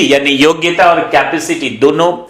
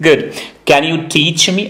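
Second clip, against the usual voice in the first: usual speaking voice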